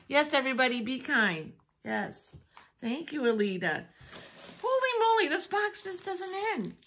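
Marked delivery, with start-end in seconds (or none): 4.6-6.7 high-pitched happy astonishment; amazed. Real, not acted. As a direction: exclaim high at a bottomless box. Astonished by an endless gift box.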